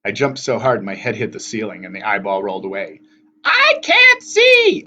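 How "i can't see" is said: slow, very high, loud shriek; panicked yell. A narrator's reading. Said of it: "all three sisters yelled".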